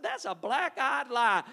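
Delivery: heated retort at the TV realtor; indignant outburst. Retold or imitated. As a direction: call out the lie loud and deliberate. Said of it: "I said".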